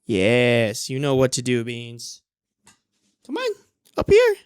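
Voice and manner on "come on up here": much higher, louder coax; coaxing call. Real, not acted. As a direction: call the cat up sweetly. Coaxing cat upward.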